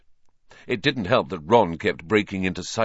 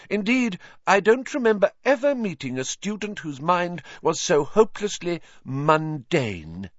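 high, slowed icy disdain; cold anger; 11 semitones higher than usual. A narrator's reading. Trelawney scolding Hermione, angry and disdainful. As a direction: belittle her with lofty contempt. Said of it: "with unmistakable anger".

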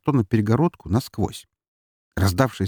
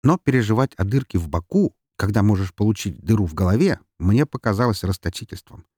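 ordinary delivery, nothing marked

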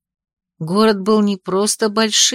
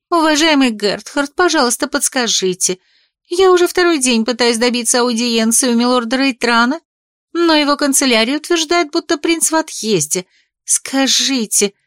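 put-on young woman; 4 semitones higher than usual. A narrator's reading. Young woman pleading for audience.

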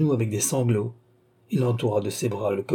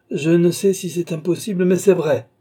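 higher, louder insistence; firm retort; 6 semitones higher, 5 dB louder than usual. A narrator's reading. Doctor answering the distraught young man, firm retort. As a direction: insist on the hard truth.

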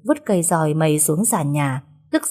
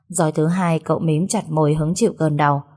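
ordinary delivery

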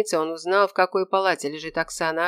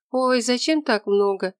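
mildly startled, higher voice; alarmed protest; 6 semitones higher than usual. A narrator's reading. Patient protests at all the fruit, mildly alarmed. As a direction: protest slowly, a touch alarmed. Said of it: "запротестовала испуганно девушка".

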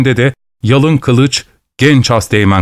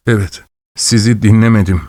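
usual speaking voice

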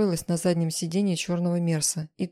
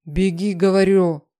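loud, drawn-out insistent command; forceful shouted order to flee; growled order; 8 dB louder than usual. A narrator's reading. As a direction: growl it loudly, stretching the words. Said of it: "зарычал он".

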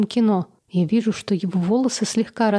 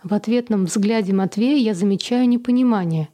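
usual speaking voice

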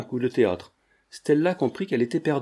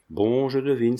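ordinary delivery, nothing marked

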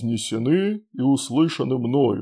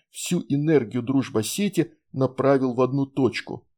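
usual speaking voice

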